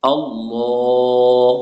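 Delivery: আল্লাহ sung long and slow with a thick, full lam; heavy lam Allah. Shown as a demonstration. Teacher to students, chanting আল্লাহ with heavy lam. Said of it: "ল পড়তে হবে মোটা করে".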